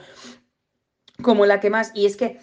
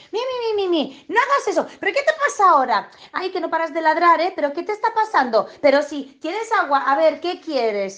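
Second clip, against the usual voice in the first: much higher, whiny pampering voice; fussy doting owner; 7 semitones higher than usual. Retold or imitated. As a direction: fret over the barking dog like a baby. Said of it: "en este tono que os estoy diciendo".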